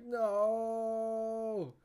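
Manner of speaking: long, loud, high-pitched "no"; mock anguished wail. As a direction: wail it out and hold the vowel. Acts out anguish at show.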